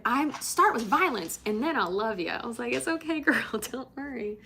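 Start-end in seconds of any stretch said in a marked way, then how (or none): 0.0-2.4 loud, high start easing into affection; imitated playful bluster. Acts out bartender explaining her outburst. 2.7-4.4 high-pitched, light comforting; retold playful reassurance. Retold reassurance to apologetic bartender.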